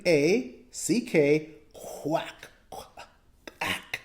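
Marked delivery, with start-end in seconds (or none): 1.7-4.0 unvoiced whispered quack. Shown as a demonstration. Teacher whispering quack during phonics drill.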